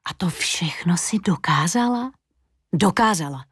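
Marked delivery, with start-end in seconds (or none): 0.1-2.1 hushed, breathy question; whispered wonder; whispered awe. Acted. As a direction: ask it in an awed whisper. Mirror asks in awe.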